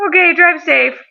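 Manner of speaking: high, chirpy mock boss voice; breezy boss. Boss brushing off a terrified worker, high mock voice. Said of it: "And he goes".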